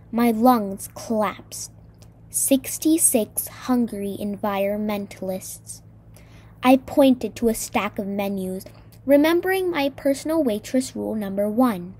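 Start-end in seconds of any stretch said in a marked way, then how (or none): none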